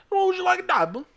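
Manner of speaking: very high, loud mock barmaid accent; shrill up into a put-on British barmaid; mock British bartender. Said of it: "she goes".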